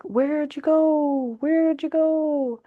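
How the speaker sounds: high, slow calling out for someone; drawn-out and high, like looking around lost; mock-bewildered searcher calling; lost, searching person.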